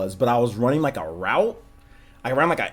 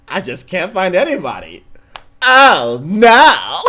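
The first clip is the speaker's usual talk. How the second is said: mimicked friend, raised then shouted; imitated over-the-top shout of dismay; super loud overreaction; 5 semitones higher, 9 dB louder, slower than usual. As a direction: build to a loud, high mock-distraught "oh, no". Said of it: "super loud".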